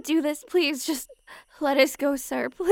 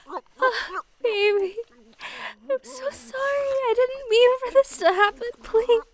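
tearful; 8 semitones higher than usual. Acted. Crying apology to boyfriend.

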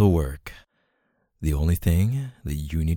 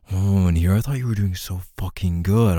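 ordinary talk

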